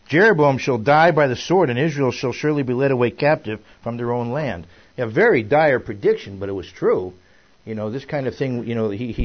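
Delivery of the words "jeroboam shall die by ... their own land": loud, measured proclamation of prophecy; emphatic scripture reading. A narrator's reading. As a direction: read the verse out with force. Reads scripture aloud to group.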